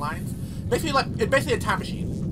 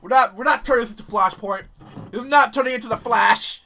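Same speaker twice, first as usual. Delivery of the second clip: voicing the guards; 4 semitones higher, 7 dB louder than usual. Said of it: "They're like".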